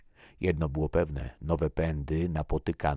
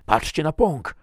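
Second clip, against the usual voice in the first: high, loud call from up front; 12 semitones higher, 11 dB louder than usual. A narrator's reading. Soldier ahead alerts the group to the bud, calling out. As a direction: call out to draw everyone's eyes. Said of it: "zawołał z przodu Tomason".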